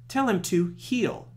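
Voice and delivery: usual speaking voice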